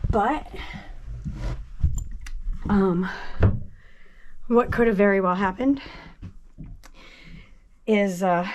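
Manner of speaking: breathless; short of breath, gasping between words. Real, not acted. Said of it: "I'm so out of breath".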